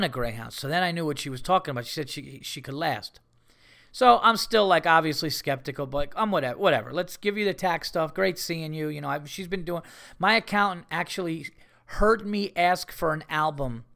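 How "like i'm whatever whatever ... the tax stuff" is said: ordinary delivery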